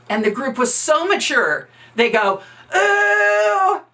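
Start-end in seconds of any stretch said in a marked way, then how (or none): 2.7-3.8 high teasing "ooh" from the crowd; high schoolyard ooh, much louder; mocking ooh. Group taunts bully in unison.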